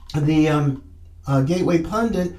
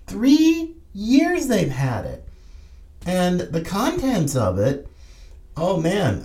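normal talking voice

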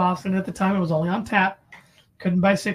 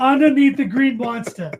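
put-on louder Boston accent, R's dropped; 5 semitones higher, 6 dB louder than usual. Telling the Green Monster story in Boston accent. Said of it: "working on that accent".

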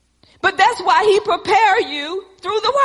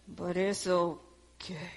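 soft resigned aside; 10 semitones lower, 16 dB quieter, slower than usual. Acted.

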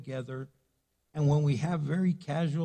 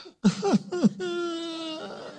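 high mock anguished cry; mock wail; 13 semitones higher than usual. Husband interrupted mid-movie, high mock wail. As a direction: wail like your night is ruined. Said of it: "And I'm like".

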